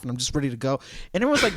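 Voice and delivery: ordinary talk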